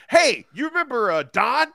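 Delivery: high, chatty Midwestern voice; Midwestern gossip. Retold or imitated. A Midwesterner shares local news, high and chatty. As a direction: drop the news casually. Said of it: "people who half their news is like".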